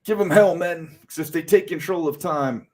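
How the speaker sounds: rallying commander. Acted. Voices commander rallying his men.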